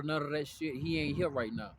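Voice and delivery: ordinary talk, nothing marked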